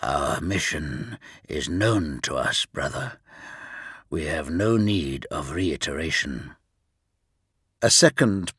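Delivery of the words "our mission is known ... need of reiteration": low, soft, hollow voice of a cold giant. Acted. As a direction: speak low and hollow, unimpressed. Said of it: "A low and hollow voice interrupted".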